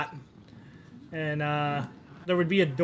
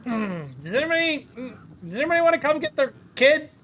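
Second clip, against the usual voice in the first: raised-pitch call to the room; bartender calling out; 9 semitones higher, 4 dB louder than usual. Acted. Bartender asking who owns this kid, calling out. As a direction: ask around with rising exasperation. Bartender calls for the kid's parent.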